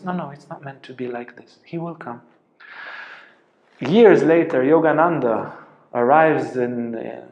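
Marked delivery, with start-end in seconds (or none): 0.0-2.2 gentle quiet refusal. Retold or imitated. Guru gently refusing the boy.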